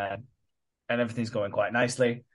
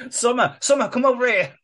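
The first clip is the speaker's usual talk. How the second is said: high, loud put-on call; mock calling out; 11 semitones higher, 6 dB louder than usual. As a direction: holler her over in a raised voice. Acting someone calling a girl over.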